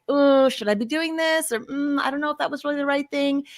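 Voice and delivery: hesitant resistance. Acted. Parent doubting their own choice, hesitant. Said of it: "anything that feels like that resistance".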